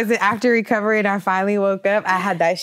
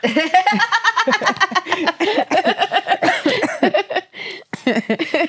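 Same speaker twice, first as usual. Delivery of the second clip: laughing; 10 semitones higher than usual. Real, not acted. Laughing at her own joke.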